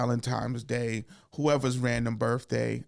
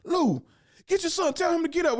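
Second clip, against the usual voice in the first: much higher, sputtering outrage; mock indignant uncle; 14 semitones higher than usual. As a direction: splutter like an offended uncle calling the dad. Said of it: "he was like".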